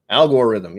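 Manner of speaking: "Algorithm" said loudly as AL-gore-rhythm; odd pronunciation. Shown as a demonstration. Streamer parading the mispronounced word, "Algorithm" with a "gore" middle. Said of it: "Except I said algorithm".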